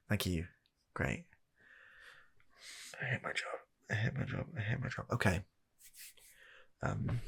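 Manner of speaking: normal talking voice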